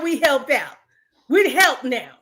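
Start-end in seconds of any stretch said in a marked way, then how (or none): none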